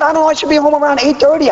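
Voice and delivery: high and fast rattled off; grudging. Retold or imitated. Telling the sponsor when he'll be home, high and rushed. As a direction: hurry through it to get off the phone.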